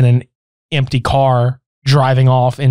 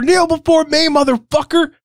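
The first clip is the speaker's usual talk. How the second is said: higher commanding voice; 12 semitones higher than usual. Retold or imitated. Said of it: "snaps out of it".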